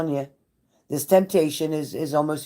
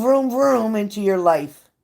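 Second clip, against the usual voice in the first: engine vroom; 6 semitones higher, slower than usual. Shown as a demonstration. Engine sound into the message.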